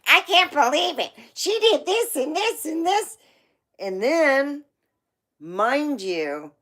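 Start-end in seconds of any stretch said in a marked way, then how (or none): 0.1-3.2 high, eager retelling to grandma; excited tattling kid. Retold or imitated. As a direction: blurt it out like a kid telling on someone. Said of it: "I told my grandmother".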